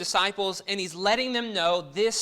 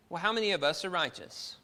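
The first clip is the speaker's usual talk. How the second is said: usual speaking voice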